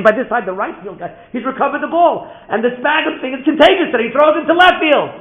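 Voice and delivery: high, loud play-by-play rush of excitement; excited climax. A narrator's reading. Father relives the contagious misthrow, excited shout. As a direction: keep shouting as the play builds. Story climax, second wild throw.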